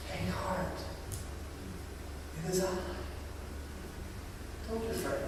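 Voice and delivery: whisper-soft, calm the fear; soft tender reassurance. Acted. Quoting Jesus's words at the stream.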